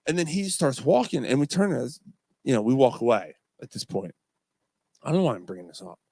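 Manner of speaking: ordinary talk, nothing marked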